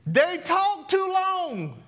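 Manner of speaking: slow, high and stretched on TOO; drawn-out high. Shown as a demonstration. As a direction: drag out "too long" in a high teasing voice. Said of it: "Give me yours".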